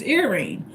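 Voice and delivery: Irene opening on a short ih; correct Greek pronunciation. Shown as a demonstration. Explaining the Greek name, correct Greek pronunciation. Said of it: "the correct pronunciation is".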